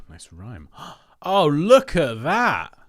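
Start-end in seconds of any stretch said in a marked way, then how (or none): 1.2-2.8 slow, louder and higher, drawn out in delight; slow, amazed oh; excited wonder. Real, not acted. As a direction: marvel at it out loud. Sees the font scaled up.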